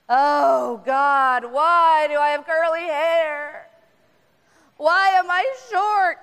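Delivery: slow, loud whine to the heavens; whiny complaint. Acted. Us complaining to God, in a loud slow whine.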